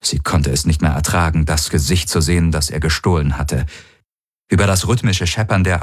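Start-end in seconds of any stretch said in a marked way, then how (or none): none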